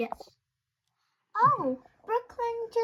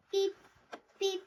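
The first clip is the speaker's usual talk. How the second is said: ordinary talk, nothing marked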